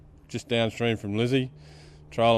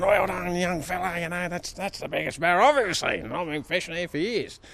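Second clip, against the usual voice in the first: high-pitched congratulating voice; applauding fellow angler; 8 semitones higher, 4 dB quieter than usual. Retold or imitated. Man in the other boat congratulating the young fella, high voice. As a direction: put on a high, softer voice of admiring praise.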